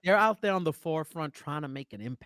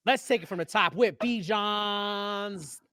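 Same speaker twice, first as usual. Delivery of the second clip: slow, high show-host announcement; slow, high-pitched segment intro; booming sing song welcome; 7 semitones higher, much slower than usual. Acted. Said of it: "Bijan Explains".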